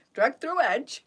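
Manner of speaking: teasing her the way Lee would; mimicking her Yorkshire. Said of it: "he'd go".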